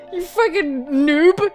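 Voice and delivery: usual speaking voice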